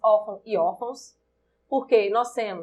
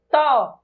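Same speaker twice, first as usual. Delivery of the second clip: "tó" split off, loud and pitched up; stressed syllable alone; 3 semitones higher, 7 dB louder than usual. Shown as a demonstration. Teacher isolating the syllable for students, emphatic. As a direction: cut it to "tó" and punch it. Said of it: "O tó é a sílaba tônica".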